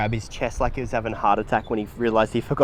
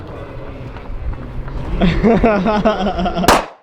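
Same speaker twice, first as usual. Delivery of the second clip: laughter; 7 semitones higher than usual. Real, not acted. Laughing after the voice crack.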